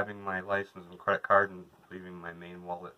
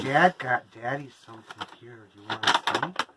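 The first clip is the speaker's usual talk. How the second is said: sweet pet-talk voice, a bit higher; talking to the dog; 3 semitones higher, 4 dB louder than usual. Real, not acted. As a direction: coo to the dog about a present. Talking to the dog about present.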